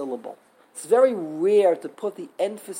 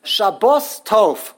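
sha-BOS Tov, slow and loud on bos; stress on last syllable; 6 dB louder, much slower than usual. Shown as a demonstration. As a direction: stretch and punch bos in Shabbos Tov. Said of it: "The last syllable is bos".

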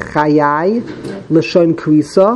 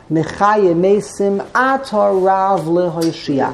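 Mechayim Meisim Ata Rav LeHoshia in one slow, joined phrase; no break; slower than usual. Shown as a demonstration. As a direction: run Meisim straight into Ata Rav without pausing. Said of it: "There is not to be a break".

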